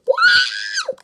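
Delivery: high, loud startled wow; sudden high shout of alarm; excited shout. Real, not acted. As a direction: yelp it out in a panic. Slime timer running out.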